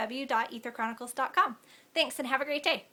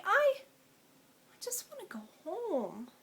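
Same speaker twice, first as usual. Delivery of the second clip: slow, breaking whisper, near tears; a frightened girl whispering that she wants to go home; broken whisper; 8 semitones higher, much slower than usual. Acted. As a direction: let the words barely come out, slowly.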